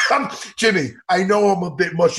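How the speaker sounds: ordinary delivery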